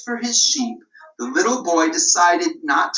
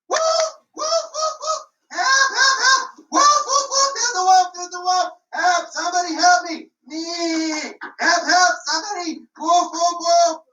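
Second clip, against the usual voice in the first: much higher frantic cries for help; crying wolf; 8 semitones higher than usual. Acted. Shepherd boy falsely crying wolf to the villagers, frantic shouting. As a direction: scream for help like the wolf is on you.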